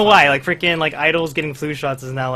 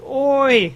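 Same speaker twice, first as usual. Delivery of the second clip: high-pitched mock "oi"; imitated yelp before the needle; 11 semitones higher, 4 dB louder than usual. Fan imitating an idol just before the needle comes, mock yelp.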